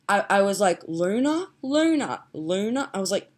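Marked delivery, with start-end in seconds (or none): 0.9-2.9 higher, questioning Australian "Luna"; Australian accent. Retold or imitated. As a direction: try the name over in an Australian accent. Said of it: "talking in an Australian accent".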